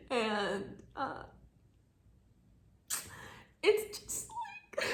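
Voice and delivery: high, choked sob-voice; words breaking into a cry; tearful, voice breaking. Real, not acted. Crying over heartbreaking book.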